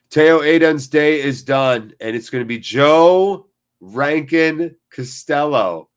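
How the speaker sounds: slow, loud, drawn-out commentator call; commentator announcement. Acted. Streamer announcing the sub to chat, slow and loud.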